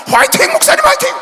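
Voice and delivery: put-on high, loud boyish cheer; bright shouted fighting cry; retold cheer. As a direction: shout it like a kid high-fiving. Boy cheering the pastor.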